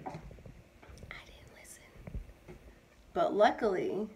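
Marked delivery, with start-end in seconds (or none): 0.0-2.6 whispered rueful aside; hushed confession. Real, not acted. Mother admitting she ignored her mom's advice, hushed confession. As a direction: drop to a barely audible whisper.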